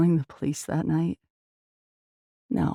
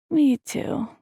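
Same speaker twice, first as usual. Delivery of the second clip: put-on sleepy little boy; 10 semitones higher than usual. A narrator's reading. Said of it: "in a sleepy voice".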